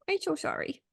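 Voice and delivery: put-on high, playful little-kid apology. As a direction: put on a tiny child's high voice. Echoed baby-talk apology, little kid.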